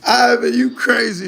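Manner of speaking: talking high through laughter; laughing. Real, not acted. Streamer teasing Ivan while still laughing.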